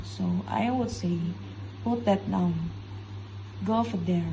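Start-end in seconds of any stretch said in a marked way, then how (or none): 1.8-4.2 commands dropping on "down" and "there"; falling command intonation. Shown as a demonstration. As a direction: give each short order a falling end. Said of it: "falling intonation is commands".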